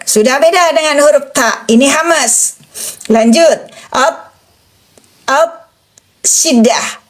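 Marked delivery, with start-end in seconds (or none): 3.9-5.5 "Ap" as a heavy t, stopped dead with no sound flowing; sound held back. Shown as a demonstration. Teacher showing syiddah, each "ap" abruptly stopped. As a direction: shut off each "ap" completely at the consonant. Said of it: "Tidak ada suara mengalir".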